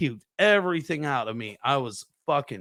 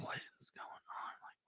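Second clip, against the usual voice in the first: barely audible whispered question; hushed, wary aside; retold uneasy whisper; 25 dB quieter than usual. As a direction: whisper it to yourself, acting puzzled. Uneasy inner reaction, retold.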